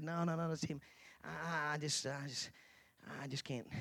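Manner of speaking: soft, stammered excuse; hesitant refusal. Retold or imitated. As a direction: back out meekly, trailing away. Retelling his hesitant refusal.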